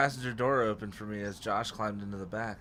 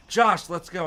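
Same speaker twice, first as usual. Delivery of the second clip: higher, louder urging; raised, insistent voice; 5 semitones higher, 7 dB louder, slower than usual. A narrator's reading. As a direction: urge your brother along. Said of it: "I urged".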